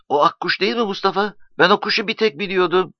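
put-on high-pitched eager boy voice; eager young boy. A narrator's reading. Child character questioning his friend Mustafa.

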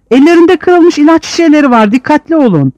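high, loud warning cry; alarmed warning. Acted. A prisoner warns his comrades, high and loud.

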